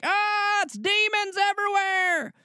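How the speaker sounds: very high, hammed-up shriek of terror; exaggerated demon-scare squeal; mock panic. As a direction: wail it in fake fright, way up high. Voices Christians panicking about witchcraft.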